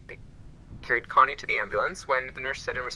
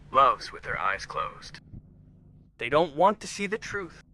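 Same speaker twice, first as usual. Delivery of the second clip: ordinary talk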